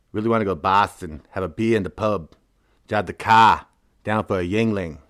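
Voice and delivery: mock Boston accent, dropped Rs; hammy Boston townie accent. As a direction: lay on a playful Boston accent. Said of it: "my best Boston accent".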